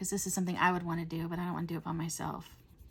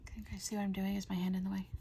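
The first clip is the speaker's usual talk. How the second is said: whispering; faster than usual. Real, not acted. Vlogger whispering to viewers while painting. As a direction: hush it like talking to herself at the canvas.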